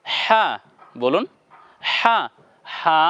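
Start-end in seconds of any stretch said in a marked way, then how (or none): 0.0-0.5 "হা" opened with audible breath; airy h. Shown as a demonstration. Teacher modelling for viewers to repeat, "হা" with air. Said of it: "বাতাস দিয়ে". 1.9-2.2 "হা" with a breathy h onset; airy h. Shown as a demonstration. Teacher repeating the letter for viewers, "হা" with air. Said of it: "বাতাস দিয়ে".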